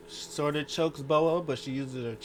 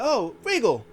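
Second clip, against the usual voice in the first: high, loud put-on recognition; imitated yelp of realization; mock surprise; 10 semitones higher, 8 dB louder than usual. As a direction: exclaim it like a startled wrestler. Wrestler recognizing Regal, acted out.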